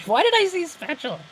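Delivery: high, giggly self-mocking question; laughing at self. Real, not acted. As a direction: laugh at your own slip of the tongue. Laughing at own slip.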